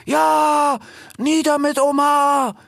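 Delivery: very high, drawn-out battle cry; crowd shout. A narrator's reading. Animals answer the rallying speech, high shouted cry. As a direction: shout it slowly as a cheering crowd. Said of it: "riefen die Tiere".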